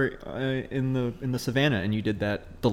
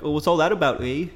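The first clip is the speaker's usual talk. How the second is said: higher-pitched Canadian lilt with eh; Canadian accent; 4 semitones higher, 3 dB louder than usual. Shown as a demonstration. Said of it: "Canadian to me is more like".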